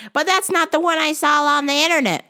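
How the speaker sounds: put-on high, loud fussy old lady; high customer voice. Old customer complaining to the clerk, high fussy whine. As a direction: whine about the vitamins.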